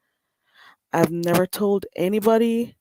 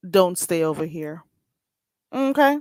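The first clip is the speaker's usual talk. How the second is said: ordinary talk, nothing marked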